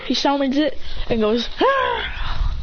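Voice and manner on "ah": high, sharp cry; a high shout as power bursts out; summoning yell. Retold or imitated. A ninja crying out as his beast appears, high.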